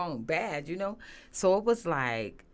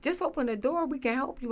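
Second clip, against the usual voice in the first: put-on kids begging; 9 semitones higher than usual. Kids begging mom through the bathroom door, high pleading. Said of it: "They like, you know".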